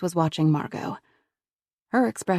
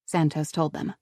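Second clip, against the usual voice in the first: plain reading voice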